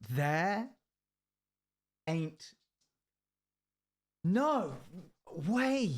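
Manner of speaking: "There... ain't... no... way", long gaps between words; "There ain't no way" said one isolated, drawn-out word at a time; each word emphasised. Shown as a demonstration. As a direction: hit each word of "There ain't no way" separately, climbing in pitch. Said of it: "put emphasis on each word individually".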